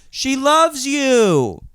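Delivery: high, loud, slow call across a theater; mock parent yell. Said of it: "a parent in the corner yell".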